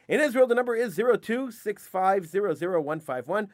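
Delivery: upbeat radio announcer giving call-in numbers; radio host plug. Acted. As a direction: sell the show like a station ID.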